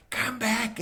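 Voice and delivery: angry yelling. Retold or imitated. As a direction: shout it after the boat as you relive the anger. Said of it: "absolute anger, just screaming".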